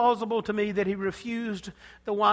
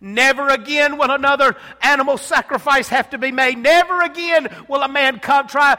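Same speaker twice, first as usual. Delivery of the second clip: triumphant preaching; 4 semitones higher, 8 dB louder than usual. Acted. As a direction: proclaim victory to the congregation. Preaching at full force.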